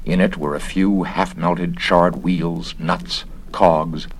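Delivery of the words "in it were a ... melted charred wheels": ordinary delivery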